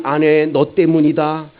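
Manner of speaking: jab the blame at the wife; mock blaming husband. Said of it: "남편은".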